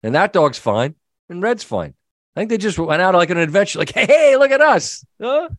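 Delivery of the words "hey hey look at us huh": high, louder, playful puppy chatter; excited dog voice. Retold or imitated. Runaway dog cheers his buddy on, high and excited. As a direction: play the runaway dog having fun.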